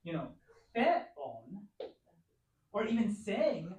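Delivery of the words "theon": theon stressed on the first syllable, THE-on; mistaken stress. Shown as a demonstration. Teacher showing a misspelt accent, theon stressed on the first syllable. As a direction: misplace the accent in theon onto THE. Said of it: "you're making a mistake".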